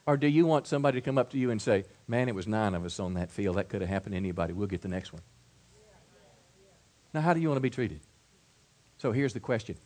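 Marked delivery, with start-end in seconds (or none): 2.1-5.2 soft, lower, reassuring voice; quiet encouragement to a teammate; consoling teammate. Acted. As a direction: comfort him after the loss.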